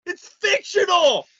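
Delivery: high, slow, shouted objection; exasperated shout. Acted. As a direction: bellow it, stretching the key word. Heated protest against film comparisons.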